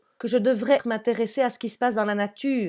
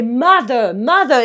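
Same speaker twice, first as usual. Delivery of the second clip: loud effortful "Mother"; 3 semitones higher, 9 dB louder, much slower than usual. Retold or imitated. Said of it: "je fais".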